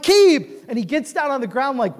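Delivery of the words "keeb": high, loud exasperated cry; scolding yell. Acted. Owner calls out his filthy dog, scolding. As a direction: yell the dog's name in dismay.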